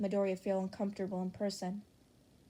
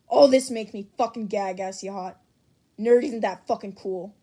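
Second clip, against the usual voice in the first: angry rant; 9 dB louder than usual. Acted. Bakugo ranting at the chat.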